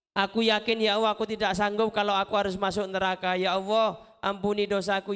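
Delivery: normal talking voice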